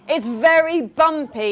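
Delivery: loud, slow yell over the bumpy sea. A narrator's reading. Truly yells in the bouncing shell, loud. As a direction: shout it out, it's bumpy. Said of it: "yells Truly".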